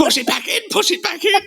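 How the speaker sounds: very high squealed urging; high comic cry through laughter; mock frantic plea. Joking panic over dangling eyeball.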